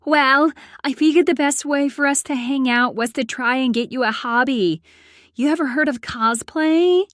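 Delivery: high, quick, eager friend voice; happy, excited. A narrator's reading. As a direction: gush about the idea, pitched well up. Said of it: "smiled happily as she responded".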